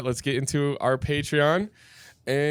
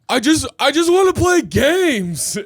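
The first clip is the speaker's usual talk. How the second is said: whiny customer; 12 semitones higher, 8 dB louder, slower than usual. Retold or imitated. Guy at jukebox wanting games.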